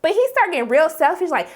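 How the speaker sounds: normal talking voice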